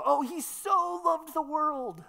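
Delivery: high, slow, swooning stress on so; gushing degree reading. Shown as a demonstration. Preacher mocking the degree reading, high and gushing. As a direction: gush over how much, dreamily. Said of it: "read it as a statement of degree".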